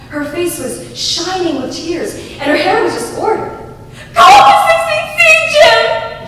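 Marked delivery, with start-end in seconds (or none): 4.1-6.3 high, loud, fast panicked scream; wife screaming at her husband for help; frantic scream. Acted. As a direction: scream at him to hurry upstairs. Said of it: "she screamed".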